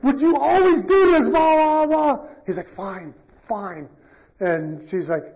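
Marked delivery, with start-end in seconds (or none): 0.0-2.3 high, loud nagging imitation of a wife; imitated quarrel, pitched way up and louder; mock exasperated outburst. Acting wife's exasperated quarrel outburst. 2.7-3.9 much softer grudging surrender; imitated muted concession; mock resigned giving-in. Acting husband giving in.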